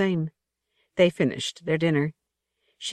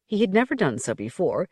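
ordinary delivery

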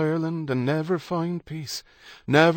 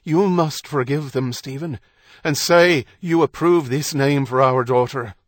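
raised, louder insistence; insistent demand; 4 semitones higher, 6 dB louder than usual. A narrator's reading. Kate demanding Stephen approve their daughter's name, insistent. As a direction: demand it of him forcefully.

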